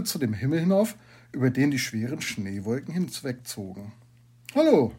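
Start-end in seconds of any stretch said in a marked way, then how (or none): 4.5-4.9 high, loud call of hello; a raised, ringing teacher's call. A narrator's reading. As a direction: call out to catch someone's attention. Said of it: "rief der Justus".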